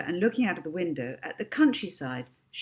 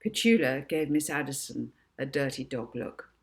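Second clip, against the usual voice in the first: ordinary delivery, nothing marked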